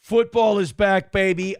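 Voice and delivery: loud excited cheer. Real, not acted. Streamer cheering that football season is back, loud and excited. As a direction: shout it like the season just kicked off. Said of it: "am I excited about this".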